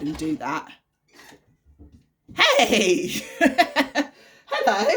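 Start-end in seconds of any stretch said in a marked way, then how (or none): 2.4-4.9 loud, bright hello with a laugh; surprised loud hello to her son; excited greeting. Real, not acted. Greeting child walking in.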